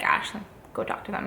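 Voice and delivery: whispered urging, the coach leaning close. Retold or imitated. Coach urges her to meet the judge, whispering. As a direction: hush it like a coach nudging her.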